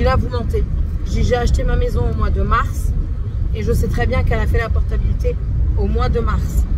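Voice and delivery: angry retelling. Retold or imitated. Retelling her angry call to the bank, heated and loud. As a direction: vent it like the call still stings. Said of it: "Je me suis énervée".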